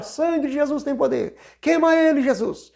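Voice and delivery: high-pitched mock cry of fervor; imitated church shouting. Worshipper carried away at an old church service, imitated shouting. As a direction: imitate an overexcited worshipper calling out. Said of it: "pra alguém começar a gritar".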